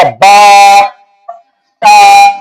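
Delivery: "با، تا" held long on one level note; demonstrated letter sound. Shown as a demonstration. Teacher showing students how با is pronounced, "با، تا" long and level. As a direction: draw out the vowels of "با" and "تا" steadily. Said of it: "با کیسے ادا ہوگا".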